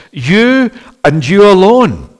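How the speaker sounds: slow, weighty stress on "You and you alone"; "You" set apart and stressed, then "you alone" slowly; emphatic YOU. Shown as a demonstration. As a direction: lean hard on both "you"s, drawing them out. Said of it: "There's the emphasis".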